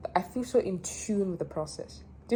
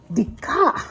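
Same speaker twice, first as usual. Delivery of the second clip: louder, higher, about to laugh; amused retelling; 4 semitones higher, 6 dB louder than usual. Real, not acted. Vlogger restarting her breakdown story, amused and loud. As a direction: announce it, then crack up.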